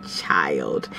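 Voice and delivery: a breathless whispered aside. Real, not acted. Whispered aside about friend.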